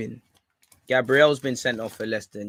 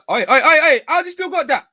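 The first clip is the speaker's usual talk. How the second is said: very high, loud yelps of alarm; panicked shouting; 11 dB louder than usual. Real, not acted. Fan watching the opponent still keep the ball, panicked shouting. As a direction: yell out in frantic disbelief.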